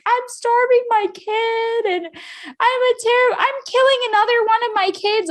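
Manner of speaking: high, loud mock wail; retold bawling. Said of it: "I just started bawling".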